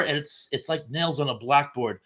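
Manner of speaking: normal talking voice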